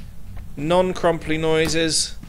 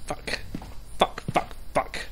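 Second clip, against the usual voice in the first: breathy, soft curses under the breath; hushed swearing; 9 dB quieter than usual. Real, not acted. Ghost hunter hissing curses under breath, hushed. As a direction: swear quietly so nothing hears you. Tense search in a haunted house.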